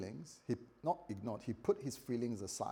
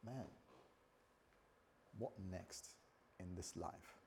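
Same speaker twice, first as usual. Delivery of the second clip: very soft, low, defeated sigh of words; hopeless; 6 semitones lower, 18 dB quieter than usual. Acted. Believer at a low point facing life, hopeless. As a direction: sink into quiet, acted despair. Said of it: "come to a place of hopelessness".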